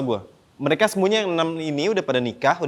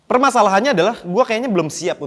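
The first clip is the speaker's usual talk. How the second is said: emphatic; 7 semitones higher, 6 dB louder than usual. Real, not acted. Comic emphasis on problem.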